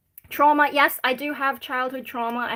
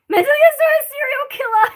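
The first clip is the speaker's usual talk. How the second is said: high, loud joking shriek of mentally ill; mock scream; 10 dB louder, faster than usual. Answering a chat comment as a pretend serial killer, mock scream. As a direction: shriek it like a horror-movie joke.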